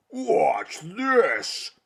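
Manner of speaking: high, loud booming giant cry; booming monster. Acted. Hot Fossifu showing off before the lake, booming cry. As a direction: boom it out like a show-off giant. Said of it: "he boomed".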